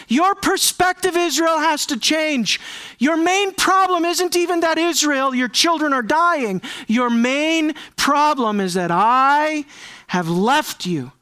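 impassioned, rising voice of God; forceful preaching. Acted. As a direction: proclaim it to Israel.

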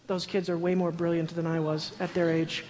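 normal talking voice

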